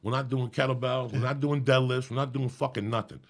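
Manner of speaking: loud, emphatic refusal; a retold furious no, words punched hard; retold angry rant. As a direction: bark it out like the friend refusing. Retold friend refusing loudly.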